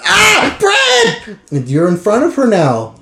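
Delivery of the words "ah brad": high, loud startled shout of his name. Acted. Seeing the ghost friend, she shouts his name loud and high. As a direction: yelp his name in shock. Spots Brad, calls out.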